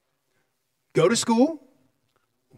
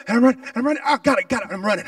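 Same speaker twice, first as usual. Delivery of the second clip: ordinary delivery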